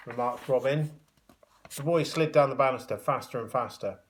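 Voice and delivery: plain reading voice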